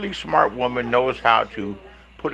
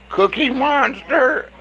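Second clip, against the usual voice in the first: high, slow sneering name-call; drawn-out taunt; 11 semitones higher, much slower than usual. Acted.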